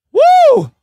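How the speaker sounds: a big high whoop, really loud; excited whoop. Real, not acted. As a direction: let out a real cheer of joy. Hype on live stream signing news.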